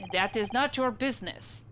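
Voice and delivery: put-on proud, broken English with a Russian accent; Russian sailor accent. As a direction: announce your name like a stern Russian crewman. Sailor stonewalls again.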